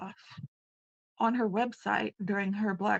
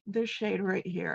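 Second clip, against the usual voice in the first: ordinary delivery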